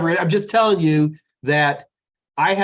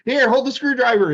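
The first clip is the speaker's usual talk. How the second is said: absorbed tinkerer; 8 semitones higher than usual. Retold or imitated. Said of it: "you're deep in thinking".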